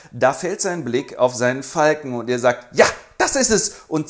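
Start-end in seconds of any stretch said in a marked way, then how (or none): 2.7-3.7 eureka. Acted. Sudden idea.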